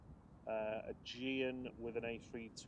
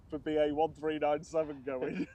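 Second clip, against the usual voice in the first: laughing; 2 semitones higher, 7 dB louder than usual. Real, not acted. Giggling while offering a flight.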